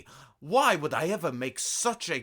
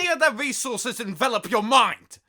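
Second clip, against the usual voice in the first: fast, loud and much higher rant; 11 semitones higher, 6 dB louder, faster than usual. Acted. Emperor ranting at his listeners, loud and fast. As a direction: rant it out at full tilt. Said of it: "through my ranting".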